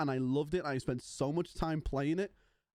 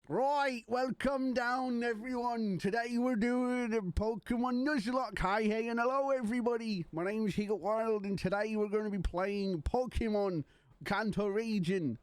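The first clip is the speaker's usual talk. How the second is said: Bristolian accent impression; 6 semitones higher than usual. Streamer imitating a friend's Pokemon intro, mock Bristolian accent. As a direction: put on an exaggerated high-pitched Bristol accent. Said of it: "the Bristolian accent".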